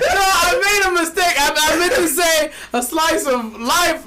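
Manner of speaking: high whiny fake-sob shout; hammed-up tearful protest; mock crying. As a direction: wail it like a sulky kid. Pretend crying about the mistake.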